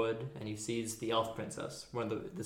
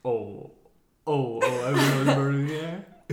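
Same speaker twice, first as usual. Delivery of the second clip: loud, incredulous echo with a laugh; mock indignation; 3 semitones higher, 9 dB louder than usual. Real, not acted. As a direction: throw the claim back, loudly scandalised. Mock reaction to co-host's claim.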